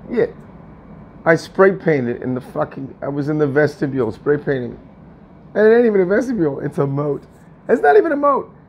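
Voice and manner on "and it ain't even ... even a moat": fast, high, rising outrage; building indignation up high; exasperated rant. Real, not acted. Ranting about the vestibule.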